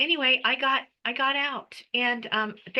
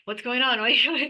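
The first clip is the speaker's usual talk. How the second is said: laughing bystanders; 3 semitones higher, 3 dB louder than usual. Retold or imitated. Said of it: "all the people were like".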